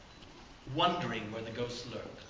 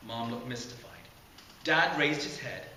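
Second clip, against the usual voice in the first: plain reading voice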